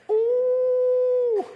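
high gasping ooh of awe; a long, high, stunned ooh. Real, not acted. Preacher reacting to Nathan's accusation, high stunned ooh.

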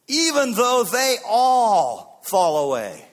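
slow, high, booming shout; bold boast. Acted. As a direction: shout the vow, stretching the words. Peter's boast to Jesus.

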